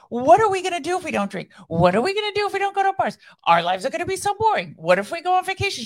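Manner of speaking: high, quick, breathless girlfriend impression; imitating sweet friend. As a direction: rattle off the worries in a high chirpy imitation. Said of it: "She's like".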